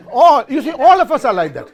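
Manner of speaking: laughing loudly through the words. Real, not acted. A speaker laughing at the audience after a trick test, laughing loudly. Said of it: "there was one answer".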